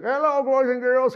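high, squeaky dummy voice through still lips; cheery high puppet greeting; ventriloquist dummy voice. Retold or imitated. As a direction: talk like a puppet without moving your mouth. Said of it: "And Henry's like".

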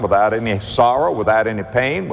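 ordinary delivery

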